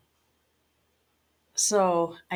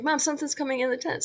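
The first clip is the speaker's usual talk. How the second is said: much higher, fast, panicky; frantic daughter; 9 semitones higher, faster than usual. Retold or imitated. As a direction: blurt it out in a rush of fright. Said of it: "she's really frantic".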